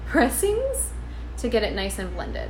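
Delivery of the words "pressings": high squeaky giggle on pressings; laughing. Real, not acted.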